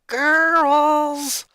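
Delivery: high, loud exasperated shout; irate call. Acted. Sister calls to her arguing sisters, irate shout. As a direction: yell to break up the fight. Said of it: "Lenny called again, becoming more irate".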